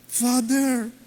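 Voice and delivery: imitating a sulky teen; hurt teen. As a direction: sound like a hurt child.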